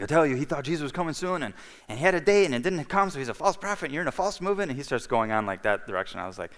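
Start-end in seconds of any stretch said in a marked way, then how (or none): 0.0-4.7 higher, fast, lecturing priest voice; heated priest. Retold or imitated. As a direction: rattle it off like a scolding cleric.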